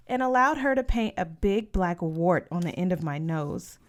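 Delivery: ordinary delivery, nothing marked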